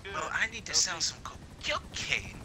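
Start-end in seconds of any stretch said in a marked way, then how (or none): 1.2-2.4 whisper. Real, not acted. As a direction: whisper the word in a hush. Whispers an illicit word.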